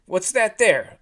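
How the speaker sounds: high, loud emotional goose wail; blubbering goose. A narrator's reading. Goose in a wreck after explaining, blubbering loudly. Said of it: "This goose was a blubbering, emotional wreck".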